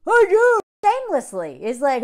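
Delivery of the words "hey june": higher, louder singsong call of June's name; shameless calling out. Retold or imitated. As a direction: call to her brightly across the room. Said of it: "he's like".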